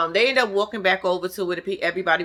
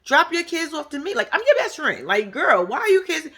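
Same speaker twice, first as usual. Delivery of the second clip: raised voice imitating Destiny; Destiny voiced higher; 8 semitones higher than usual. Retelling Destiny begging to be her village, higher. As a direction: pitch it up as the eager friend. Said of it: "Destiny goes".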